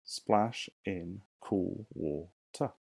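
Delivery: each syllable said slowly and apart; slow syllable count. Shown as a demonstration. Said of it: "Right here".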